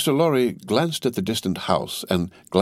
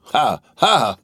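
forced, louder "ha, ha"; two separate, irritated laughs; vexed laugh; 6 semitones higher, 5 dB louder than usual. A narrator's reading. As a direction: laugh humorlessly, higher and louder. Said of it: "laughed Stryver with a vexed laugh".

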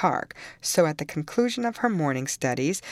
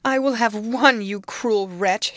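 higher, much louder furious scream; girl screaming at her nurse by the fire; 7 semitones higher, 6 dB louder than usual. A narrator's reading. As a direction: scream it in rage. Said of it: "she screamed".

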